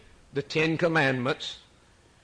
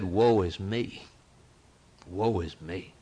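slow, hushed lament fading softer; quiet lament; 5 semitones lower, slower than usual. Acted. Preacher voicing Isaiah's lament, a quiet mild grief. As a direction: let the woe sink low and soft. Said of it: "he said".

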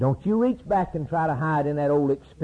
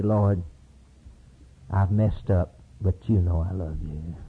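humble confession; 7 semitones lower than usual. Acted. Peter confesses to the Lord.

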